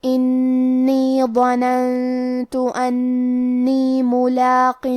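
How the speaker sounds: slow, melodic tajweed chant; Quran recitation. Acted. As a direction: recite each word drawn out like in prayer. Quran recitation during lesson.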